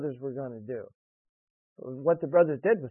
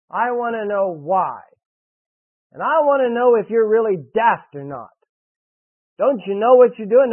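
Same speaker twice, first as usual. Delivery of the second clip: preaching at full force; 4 semitones higher, 6 dB louder than usual. Real, not acted. Preacher challenging children in the congregation, loud and pressing. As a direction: press the question hard.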